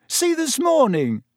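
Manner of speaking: high, loud amazed reading-out; can't believe what he's reading; a boy amazed at his friend's timetable; disbelieving. A narrator's reading. Ron disbelieving at schedule.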